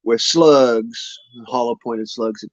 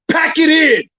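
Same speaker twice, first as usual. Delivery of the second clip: much higher, louder furious yell at the dog; drill sergeant shout; 5 dB louder than usual. Real, not acted. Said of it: "pull out my drill sergeant voice".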